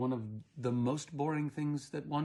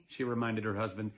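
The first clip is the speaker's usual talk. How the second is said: usual speaking voice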